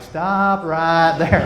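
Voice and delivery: slow, drawn-out halt; stop right there. Retold or imitated. Husband stopping his wife's plan mid-sentence, slow and firm. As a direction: drag each word out to stop her cold.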